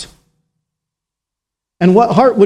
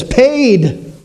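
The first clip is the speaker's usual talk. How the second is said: voice of the blood; 3 semitones higher, slower than usual. Acted. Preacher voicing the blood rising to heaven, exultant. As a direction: ring out each word like a verdict. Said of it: "every drop of blood lifts its voice".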